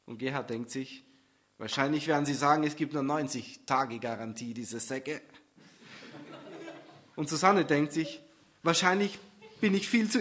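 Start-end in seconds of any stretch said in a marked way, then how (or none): 1.6-5.2 lower, muttering contempt; grumbling boyfriend. Acted. Gerhard expecting the garage's excuse, low grumble. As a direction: spit out diese Säcke low.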